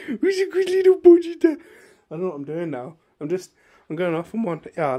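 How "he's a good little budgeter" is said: squeaky cutesy voice. Real, not acted. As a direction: coo it like to a pet. Gushing over figure, squeaky voice.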